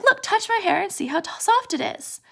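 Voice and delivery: high, girlish-eager acted invitation. Curly's wife inviting Lenny to feel her hair, eagerly. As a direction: coax lightly, pitch up high.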